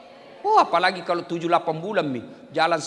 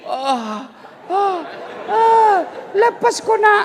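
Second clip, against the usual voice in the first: rising, louder cries of pretend pain, ending in a laugh; mock pain building; 13 semitones higher, 6 dB louder, much slower than usual. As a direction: play the hurt getting worse, then laugh it off. (erangan pura-pura yang makin tinggi dan keras, lalu tertawa)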